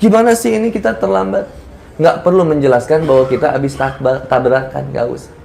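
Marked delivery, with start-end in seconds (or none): 0.0-1.4 high-pitched mock scolding; mildly cross, raised-pitch complaint; imitating annoyed scolding. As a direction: scold like a fed-up event organiser. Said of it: "Panitia marah-marah".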